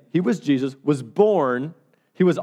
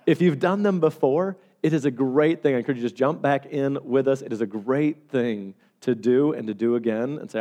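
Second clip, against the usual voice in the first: ordinary delivery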